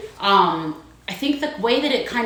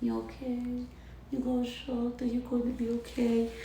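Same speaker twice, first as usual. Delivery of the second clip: very soft, slightly raised voice; quiet soothing; 3 semitones higher, 13 dB quieter than usual. Real, not acted. Wife checking on her shocked husband, softly soothing. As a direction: check on someone in a small, soft voice.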